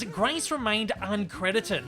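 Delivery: ordinary talk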